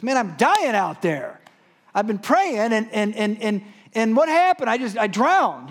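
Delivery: loud, high, exasperated complaint; worked-up acted grievance; indignant protest. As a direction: plead your case loudly to God. Drowned man protests to God.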